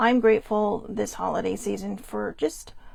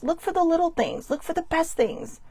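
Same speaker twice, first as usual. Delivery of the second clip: ordinary talk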